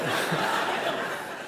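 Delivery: a soft breathy chuckle; quiet voiceless laugh. Real, not acted. Reacting to the YouTube comments, a quiet laugh.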